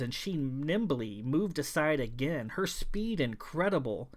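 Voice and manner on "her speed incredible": plain reading voice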